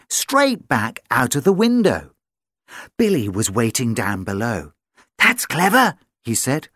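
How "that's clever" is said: dog voice. Acted. Billy speaks.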